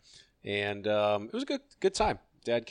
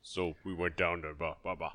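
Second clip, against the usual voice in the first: low, distorted voice-changer growl; deep distorted monster voice; 6 semitones lower, 10 dB quieter than usual. Pretend. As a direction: speak low through a voice changer. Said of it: "Like a monster voice".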